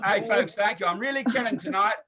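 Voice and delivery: ordinary talk, nothing marked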